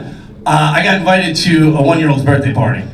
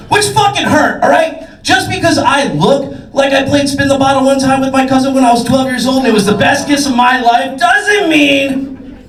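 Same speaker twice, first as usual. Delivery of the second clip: high-pitched indignant outburst, stretched at the end; mock wounded rant; 6 semitones higher than usual. Comic snubbed by a mother at party, indignant rant. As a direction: protest the snub with comic hurt.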